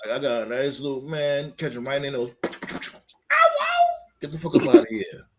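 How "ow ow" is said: very high imitated "ow ow"; mock yelp. Imitating someone caught right in those, high mock yelp. Mimics a bitten victim or animal.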